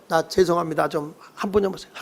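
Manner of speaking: soft, low pleading, acted; hushed, humbled mock plea; meek apology. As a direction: beg forgiveness quietly, as a ruined enemy. Ruined enemy comes begging.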